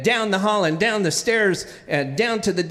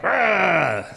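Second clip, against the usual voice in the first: rough imitated growl. Shown as a demonstration. Mean coach at the young swimmer, rough growl. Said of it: "instead of growling at me like".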